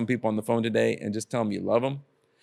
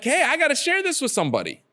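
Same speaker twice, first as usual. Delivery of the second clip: much higher, excited exclamation; eager acted quote; 12 semitones higher than usual. Believer who learned from the Bible wants to share, eager excitement. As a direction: pitch it right up, eager to pass it on.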